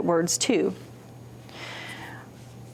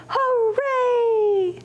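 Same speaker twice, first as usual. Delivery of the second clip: high, bright cheer; cheerful hooray; 13 semitones higher than usual. Shown as a demonstration. Librarian cheers hooray with children, high and joyful. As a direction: whoop it up for the kids. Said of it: "shout hooray".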